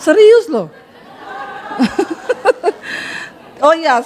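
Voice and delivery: ordinary talk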